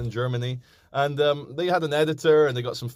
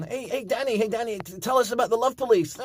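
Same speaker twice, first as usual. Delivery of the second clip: high mock heckler voice; mocking Danny; 10 semitones higher than usual. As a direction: sneer it like a heckler egging him on.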